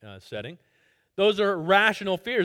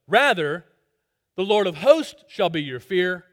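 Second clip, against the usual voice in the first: ordinary delivery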